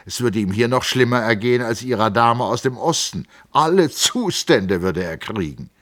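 put-on young man explaining his family; lightly voiced Hans Castorp. A narrator's reading. Castorp telling Settembrini about his uncles, lightly voiced.

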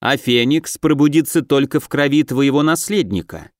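ordinary delivery, nothing marked

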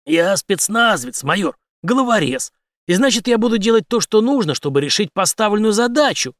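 forceful, high, emphatic declaration; hard commando. A narrator's reading. Henry telling the major he is a cutthroat, forceful. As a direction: state it as a threat.

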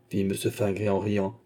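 ordinary delivery, nothing marked

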